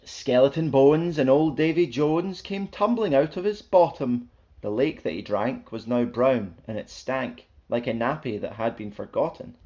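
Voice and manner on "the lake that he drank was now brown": ordinary delivery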